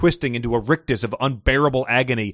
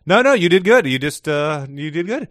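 higher, louder eager reassurance; reassuring; 4 semitones higher, 4 dB louder than usual. Real, not acted. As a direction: hurry to comfort them. Reassuring a worried contestant.

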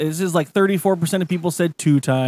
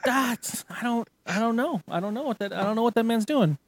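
bewildered mumble; 5 semitones higher, 7 dB quieter than usual. Retold or imitated. Bewildered at caller.

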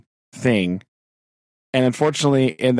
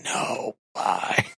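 hushed, voiceless mock lament; retold dismay; 5 semitones lower, 7 dB quieter, much slower than usual. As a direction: reenact a weak, whispered groan of dread. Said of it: "just going".

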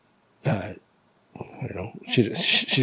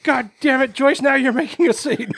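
mock exasperation; 8 semitones higher than usual. Acting exasperated scolding of Joyce.

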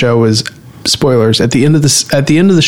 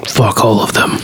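low, rough Birdman inner voice; gravelly voice; 6 semitones lower than usual. Acted. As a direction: growl it low like a brooding superhero. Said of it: "Excellent gravelly voice".

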